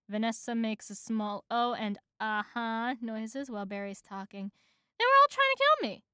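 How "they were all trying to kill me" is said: high, loud, fast protest; frantic bee. A narrator's reading. Bee explains they tried to kill him, frantic. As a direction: rush it out, pitched high. Bee pleads his case.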